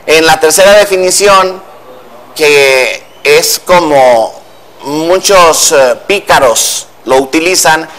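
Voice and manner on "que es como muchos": ordinary delivery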